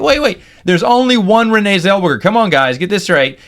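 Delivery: high, urgent joking interruption; mock protest. Joking with coworkers all volunteering, mock-exasperated.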